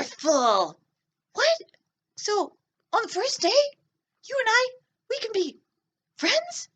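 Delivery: put-on high, bright little-boy puppet voice; puppet kid voice. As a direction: play a nervous little boy talking to the class. Teacher voices puppet Joe for kids.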